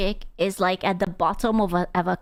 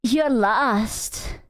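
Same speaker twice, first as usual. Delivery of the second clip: ASMR role-play; 2 semitones lower, slower than usual. Retold or imitated. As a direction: imitate a slow-burn ASMR role-play, partly whispered. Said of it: "She's role-playing".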